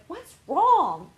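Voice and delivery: a partner's sudden worried question; partner asking. Retold or imitated.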